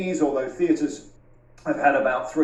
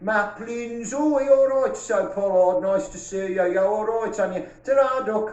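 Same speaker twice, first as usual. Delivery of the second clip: higher, playful Brummie impression of camp staff; mock Birmingham banter, ta-ra duck; light Birmingham accent, hammed up for laughs; 4 semitones higher than usual. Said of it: "This is my Birmingham accent".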